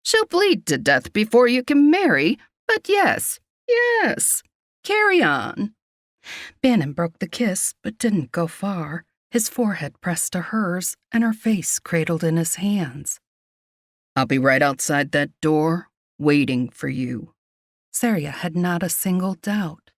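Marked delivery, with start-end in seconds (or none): 0.0-5.7 high, exasperated healer voice; grumbling old healer. A narrator's reading. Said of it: "the old healer grumbled". 14.2-17.3 low male character voice; devoted warrior. A narrator's reading. Bannon promises Seria he'll wait.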